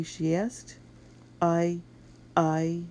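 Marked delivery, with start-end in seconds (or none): 1.4-2.8 halting, higher-pitched stammer. A narrator's reading. Boy answering the mistress, stammering. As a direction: stall and restart on the word I. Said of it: "Matt stammered".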